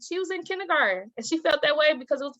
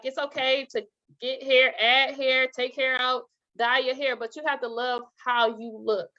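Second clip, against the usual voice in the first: ordinary talk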